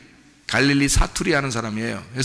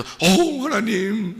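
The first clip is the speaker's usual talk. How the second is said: mock awestruck crowd; 7 semitones higher, much slower than usual. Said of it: "자기 나라 말로 다 해서".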